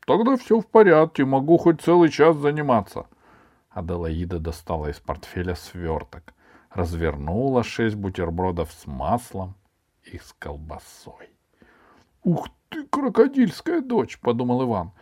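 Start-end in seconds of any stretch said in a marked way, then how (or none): 0.1-3.1 put-on high, loud boyish voice; lively lazy-pupil character voice; wheedling schoolboy. A narrator's reading. 12.2-14.2 put-on very high, squeezed boy's voice; indignant high-pitched boy's thought; resentful schoolboy. A narrator's reading. Ivan inwardly curses the girl.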